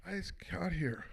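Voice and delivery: soft unvoiced aside to the crowd; new boss muttering under his breath; whispered aside. Real, not acted.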